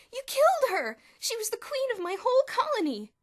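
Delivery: high piercing shriek. A narrator's reading. Elmer shrieking at Bruno for killing his ant, very high and loud. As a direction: scream it in horror. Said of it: "Elmer shrieked".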